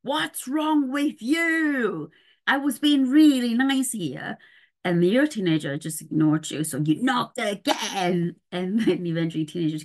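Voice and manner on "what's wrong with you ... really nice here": slow, high exasperated demand, mildly imitated; a parent at the teen's door, exasperated and hurt; angry parent. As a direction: replay the frustrated parent at the door. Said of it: "knock on the door and go".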